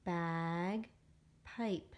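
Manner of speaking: Bagpipe said slowly, both syllables held; stretched word reading. Shown as a demonstration. Teacher reading Bagpipe along with the children, drawn out. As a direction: read Bagpipe slowly with the class. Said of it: "read it with me".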